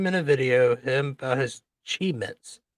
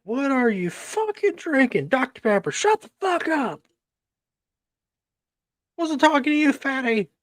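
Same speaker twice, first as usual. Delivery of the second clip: high mock yell; 10 semitones higher than usual. Yelling at Doctor Pepper.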